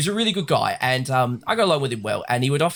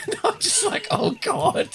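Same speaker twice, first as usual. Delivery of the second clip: laughing. Real, not acted. Laughing at coworker's story.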